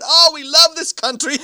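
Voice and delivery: high, loud, laughing delight; laughing Russian students. Retold or imitated. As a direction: burst out laughing with joy. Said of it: "they all burst out laughing and said".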